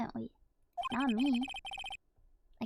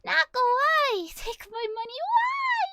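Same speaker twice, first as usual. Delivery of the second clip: overwhelmed squeal; 13 semitones higher, 7 dB louder than usual. Real, not acted. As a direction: wail in grateful disbelief. Overwhelmed by donation.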